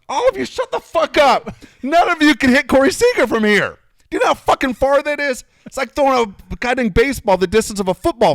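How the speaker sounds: furious high yell at the loudmouths; retold blow-up, yelled high and loud; snapping shout. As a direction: let it all out, shouting them down. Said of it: "And I just snapped".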